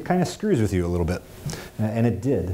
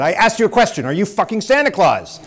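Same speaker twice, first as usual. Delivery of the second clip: furious high-pitched drill-sergeant yell; angry sergeant; 11 semitones higher, 6 dB louder than usual. Retold or imitated. Gunnery sergeant demands an answer from prisoner, furious shout. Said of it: "what does the angry man want".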